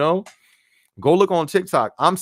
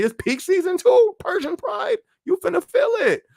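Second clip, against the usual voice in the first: much higher, squeaky teasing warning; high squeaky tease. Acted. Streamer teasing a new Amazon worker about the soreness, in a high squeaky voice.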